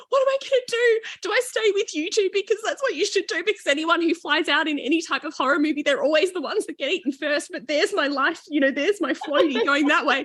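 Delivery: put-on high, frantic voice of a scared teen; high-pitched panicky girl torn between staying and saving her floaty; panicked daughter. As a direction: squeal it out in a panic. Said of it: "now she's standing here going".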